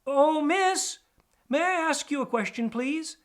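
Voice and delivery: high, loud timid rat voice; calling politely. Acted. DJ calls up to the sparrowhawk in the elm, high. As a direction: call up to her politely. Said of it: "He called out to her".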